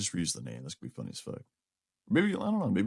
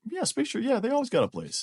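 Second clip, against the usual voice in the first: excited; 3 dB louder than usual. Real, not acted. Excited about space sharks.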